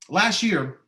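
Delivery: ordinary talk, nothing marked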